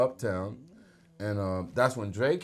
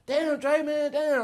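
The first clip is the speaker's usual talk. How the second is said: lightly high flattering hype; showing off; 13 semitones higher, slower than usual. Retold or imitated. Complimenting Dre's office, lightly high showing-off voice. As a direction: talk him up, a little high and over-friendly. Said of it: "and I'm talking like that".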